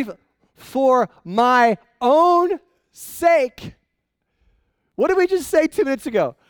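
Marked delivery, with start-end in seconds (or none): 0.0-3.7 much louder and very slow on "my own sake"; slow emphatic reveal. Shown as a demonstration. Pastor revealing the key phrase to the church, slow and loud. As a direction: drag out "For, for my own sake" word by word.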